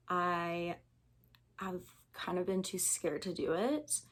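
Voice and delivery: normal talking voice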